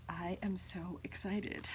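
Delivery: excited whisper. Real, not acted. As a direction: whisper it in awe. Unboxing climax.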